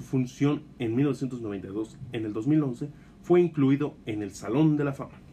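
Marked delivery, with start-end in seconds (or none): none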